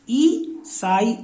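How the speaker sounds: ई साई drawn out slowly, each syllable apart; slow syllable split. Shown as a demonstration. Teacher sounding out ई साई slowly for the class. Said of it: "ई की मात्रा ये आ गई".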